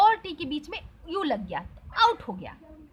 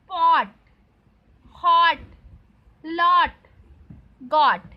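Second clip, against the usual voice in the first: पॉट, हॉट, लॉट, गॉट each loud and separate, long pause after; careful word-by-word modelling; 7 dB louder, much slower than usual. Shown as a demonstration. Teacher reading for students to listen closely, पॉट, हॉट, लॉट, गॉट loud and spaced. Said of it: "अब चलिए मैं पढ़ रही हूँ".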